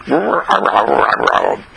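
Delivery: playful "row row" dog noises, first one high; imitated dog woofs. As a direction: voice the dog grumbling happily. Bowser answers in dog talk.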